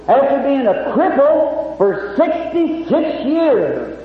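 slow, weighty testimony line; slow preaching. Real, not acted. Preacher to the audience, slow and weighty. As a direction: lean on every word.

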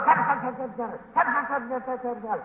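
soft, rapid-fire squirrel noises; imitated squirrel scolding; squirrel chatter. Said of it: "that little tail curled up, going".

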